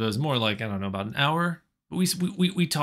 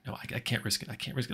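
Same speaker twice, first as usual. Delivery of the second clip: hushed refusal; 9 dB quieter, faster than usual. Retold or imitated. Joe refuses to swap numbers, softly. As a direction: back away from it quietly.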